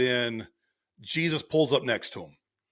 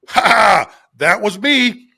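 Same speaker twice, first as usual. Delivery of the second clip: loud, higher triumphant shout; spring the prank with a big "ha ha"; gleeful prank reveal; 6 semitones higher, 11 dB louder, slower than usual. Acted. Jesus springing his prank, acted.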